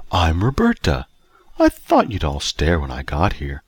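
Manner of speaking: enticing female character voice; husky, enticing woman. A narrator's reading. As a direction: introduce yourself seductively. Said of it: "Her voice was huskier".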